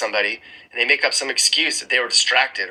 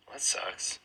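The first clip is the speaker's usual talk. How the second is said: whispered aside; 3 semitones lower, 15 dB quieter than usual. Real, not acted. Aside on poor upward mobility.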